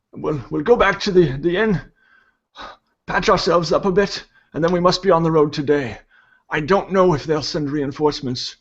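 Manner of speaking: put-on high old man's voice, urgent then easing; insistent old priest. Old priest urging the party to continue, insistent.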